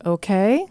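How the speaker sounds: okay lifted up as a question. Shown as a demonstration. Parent asking the kid's permission, rising okay. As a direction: end on a hopeful rising okay?. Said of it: "They're asking a question".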